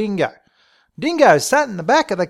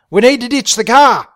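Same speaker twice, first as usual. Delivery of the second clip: high, loud urgent cry; urgent alarm; 6 semitones higher, 9 dB louder than usual. Acted. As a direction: call it out as an emergency. Panicked decision after police report.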